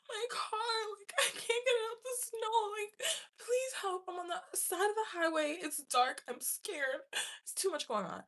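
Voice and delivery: high, tearful plea for help, very high; crying plea. Retold or imitated. Stuck driver calling the men for help, crying high. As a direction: sob it out, begging for rescue. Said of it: "I'm, like, crying".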